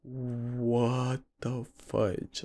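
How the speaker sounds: very soft, slow disbelief; stunned inner voice. Retold or imitated. Thinking it as the friend's eyes roll back, hushed shock. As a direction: say it under your breath, drawn out. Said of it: "Like, in my head".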